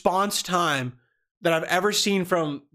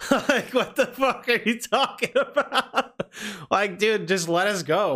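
higher, slow, laughing through the words; laughing disbelief; 6 semitones higher, slower than usual. Real, not acted. Recalling the cop's jail threat to a freshman, laughing in disbelief.

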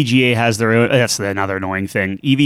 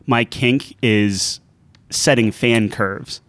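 slow deadpan; much slower than usual. Retold or imitated. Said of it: "a funny thing out that was like".

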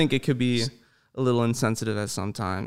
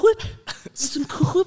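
cartoon voice; much slower than usual. Doing Family Guy voices.

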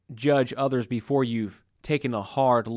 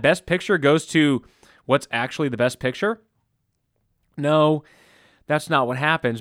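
usual speaking voice

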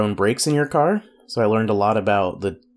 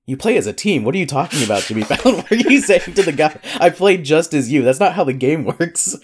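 incredulous, laughing; 6 semitones higher, 3 dB louder than usual. Acted. Retorting to Fallon, laughing.

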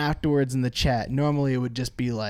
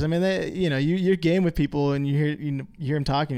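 ordinary talk, nothing marked

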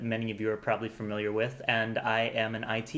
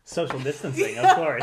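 laughing; 13 semitones higher, 9 dB louder than usual. Real, not acted. Guest jokes, laughing.

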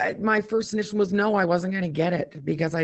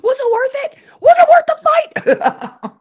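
teasing shout; 9 dB louder than usual. Pretend.

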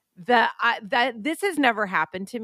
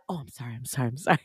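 soft flustered apology; 8 semitones lower, 5 dB quieter, much faster than usual. Retold or imitated. Masseuse apologising after dropping stones.

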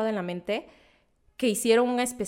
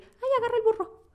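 much higher, mocking a bad cartoon Oaxacan accent; terrible fake Oaxacan accent. Telling a friend about the cartoon girl, mocking a terrible Oaxacan accent. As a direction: parody the cartoon's awful Oaxacan accent, pitched way up. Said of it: "con un acento horrible".

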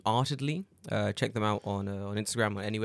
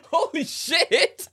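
much higher, slow, laughing exclaim; laughing shock; 8 dB louder, much slower than usual. Real, not acted. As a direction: burst out at the absurd sight. Sees the kanji, laughs.

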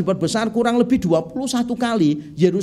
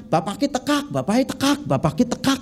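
put-on higher, softer Banyumas ngapak accent, "tekak" clipped hard at the end; 5 semitones higher, 3 dB quieter than usual. Preacher imitating a Banyumas man announcing father's arrival in ngapak accent. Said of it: "ngomongnya beda".

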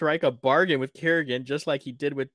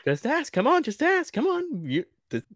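excited, high-pitched pleading; giddy wheedling, like an overeager kid; Jasper; 10 semitones higher, 5 dB louder than usual. Acted. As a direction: pester eagerly, voice leaping way up. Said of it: "He was so excited".

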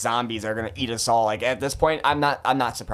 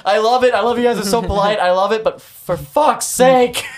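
high, loud, overwhelmed outburst; gushing, then snapping at the politeness; exasperated rant; 10 semitones higher, 8 dB louder than usual. Retold or imitated. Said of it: "Just straight up, like".

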